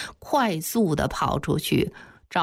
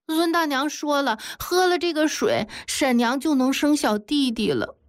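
put-on higher-pitched child comforting through pain; brave little child; 6 semitones higher than usual. A narrator's reading. Hurt child consoles weeping grandfather, bravely enduring pain. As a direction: play a small child soothing a grown-up. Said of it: "忍着伤痛".

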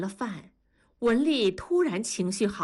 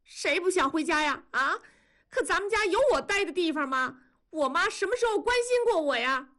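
much higher, tearful fast protest; crying protest; 10 semitones higher, faster than usual. A narrator's reading. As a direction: cry out that nobody cares for her. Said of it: "多多哭闹着".

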